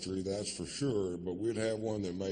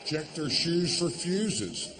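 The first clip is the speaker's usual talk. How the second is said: loud, slow and higher, spelling it out; emphatic shout; 8 semitones higher, 4 dB louder, slower than usual. Retold or imitated. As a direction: hammer the advice home for mom. Punchline advice shouted at mom.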